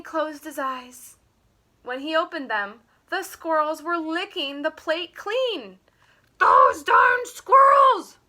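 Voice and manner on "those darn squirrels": much higher, louder, slow furious yell; angry shout. Acted. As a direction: bellow it like a grump who lost his pie. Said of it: "shouted Fookwire".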